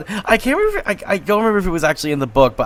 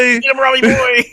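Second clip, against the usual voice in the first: higher, louder rallying cheer; cheering shout; 8 semitones higher, 4 dB louder than usual. Pretend. Friend cheering on the young king, loud fan yell.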